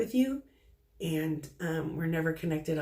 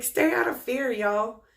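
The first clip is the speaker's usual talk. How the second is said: spirit's urgent call; 4 semitones higher, 5 dB louder than usual. Retold or imitated. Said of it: "he's like".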